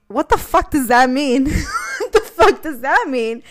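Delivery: disbelieving laugh. Retold or imitated. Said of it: "And I was like".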